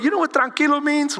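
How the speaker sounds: ordinary talk, nothing marked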